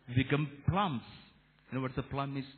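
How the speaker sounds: ordinary delivery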